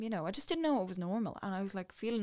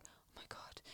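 whispered oh my God in dread; breathless whisper; 18 dB quieter than usual. Retold or imitated. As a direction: breathe it out barely voiced, stunned. Reacting to doctor's warning.

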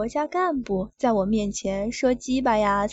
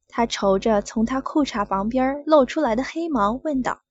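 usual speaking voice

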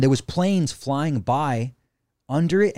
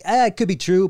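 high, louder mock shrug; half-hearted doubt; 8 semitones higher, 5 dB louder than usual. Telling friend how he shrugs off aliens, mock shrug. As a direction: play up a noncommittal maybe. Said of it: "I'm like, I'm like".

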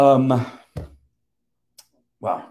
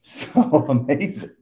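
slower, laughing as the words come; laughing through it; 6 semitones higher, 4 dB louder, slower than usual. Retold or imitated. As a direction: let a laugh break into the line. Retelling joyful moment.

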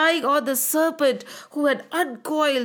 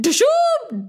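splash sound; 12 semitones higher than usual. Acted. Diving sound effect.

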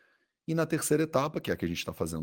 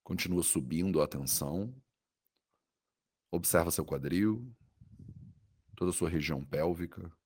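soothing guided meditation. Acted. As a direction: guide the body scan gently, with long pauses.

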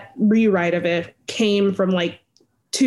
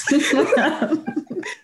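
real high giggle; laughing; 8 semitones higher than usual. Real, not acted. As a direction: let a high laugh bubble out. Laughs at her own story.

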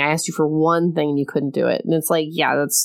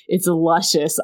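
ordinary talk

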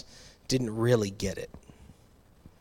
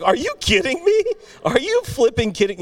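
much higher, louder, exasperated mom voice; frustrated mom; 4 dB louder than usual. Retold or imitated. Mom snapping at dad who wants to leave, high exasperated outburst. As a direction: shriek it like a fed-up mom. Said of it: "she's like".

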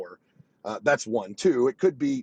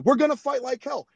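higher, punchy quoted war cry; mock rally shout; 8 semitones higher, 4 dB louder than usual. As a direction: belt it like a rally chant. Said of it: "He said".

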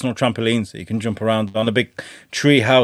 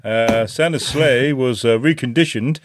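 Matt Spalding. Retold or imitated. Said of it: "Matt Spalding impression".

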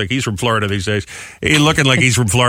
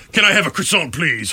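put-on French accent; 3 semitones higher than usual. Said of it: "fake French accent".

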